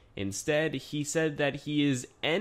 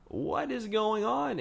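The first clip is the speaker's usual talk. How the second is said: much higher, slow what is going on; bewildered; 10 semitones higher, slower than usual. Real, not acted. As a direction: stretch it in disbelief. Mystery twist in the storyline.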